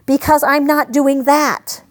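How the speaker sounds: normal talking voice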